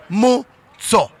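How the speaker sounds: Khomotso drawn out slowly, syllable by syllable; slow name drill. Shown as a demonstration. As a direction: teach Khomotso slowly, every syllable clear. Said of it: "Let's start again".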